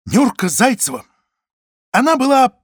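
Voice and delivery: loud, high build to the insult; loud proclamation. Acted. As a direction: savour it for the crowd. Паша смачно выговорила. (смачное громкое объявление)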